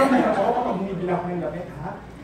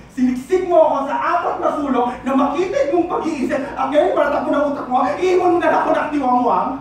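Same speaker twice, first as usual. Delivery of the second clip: raised, forceful tirade; angry accusation; 3 semitones higher, 5 dB louder than usual. Acted. Jilted partner accusing the lover of moulding them, angrily. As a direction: hurl the blame, loud and high.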